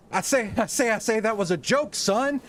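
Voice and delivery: loud, high Southern bluster; hammed-up cartoon Southerner. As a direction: boom it out like a pompous Southerner. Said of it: "You could probably do a fo".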